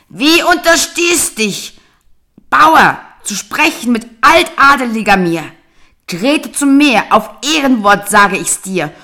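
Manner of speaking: tower over the peasant husband; old wife berating her husband; furious shout. Acted. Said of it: "Erzürnte sich noch mehr die Alte".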